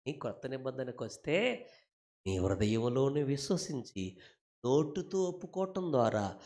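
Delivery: usual speaking voice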